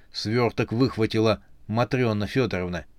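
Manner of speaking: ordinary delivery, nothing marked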